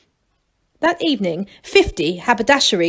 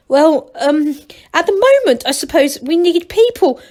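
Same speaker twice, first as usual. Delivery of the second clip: flustered; 7 semitones higher, 5 dB louder than usual. A narrator's reading. Masklin flustered before the Duke.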